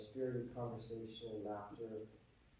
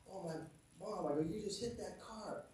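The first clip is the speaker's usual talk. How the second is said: retold astonishment; 8 semitones higher than usual. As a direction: replay your shocked reaction to mom. Retelling his shocked reaction to mom.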